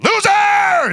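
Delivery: very loud, high, drawn-out shout; mock-triumphant yell; gloating taunt. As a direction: imitate a kid crowing in victory. Son taunts him back.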